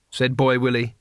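usual speaking voice

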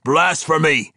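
loud, high denunciation; accusing dwarf shout; indignant outburst. A narrator's reading. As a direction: cry out in outrage. Dwarf denounces Bruenor's claim.

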